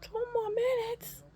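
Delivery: very high-pitched incredulous echo; retold dismayed disbelief. Patient echoing the nurse in dismay, high and incredulous. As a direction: squeal it back, aghast.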